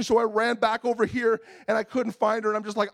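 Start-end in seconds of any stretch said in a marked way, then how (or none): none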